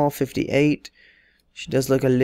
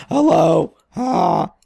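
louder, mock lady's hello; 3 semitones higher, 3 dB louder, much slower than usual. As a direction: put on her voice, pushed up and loud. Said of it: "that's how she sounds".

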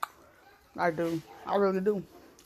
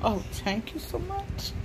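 higher, slow, soft thanks; 7 semitones higher, 8 dB quieter, slower than usual. Real, not acted. Thanking the toddler for the kiss.

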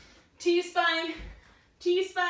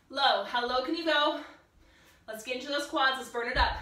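ordinary delivery

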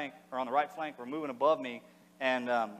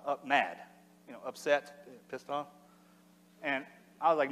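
ordinary delivery

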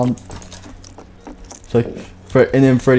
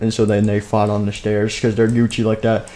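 plain reading voice

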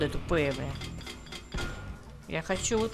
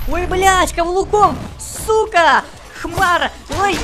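angry; 7 semitones higher, 6 dB louder, slower than usual. Real, not acted. Ругается на удар каблуком.

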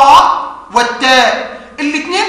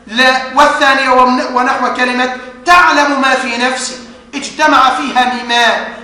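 normal talking voice

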